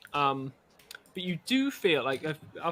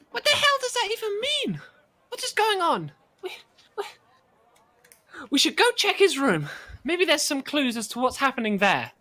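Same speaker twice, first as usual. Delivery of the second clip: much higher, louder, alarmed outburst; a frantic voice, pitched way up in alarm; panicked companion; 13 semitones higher, 5 dB louder than usual. Acted. Companion panicking over the strange letter, much higher and louder.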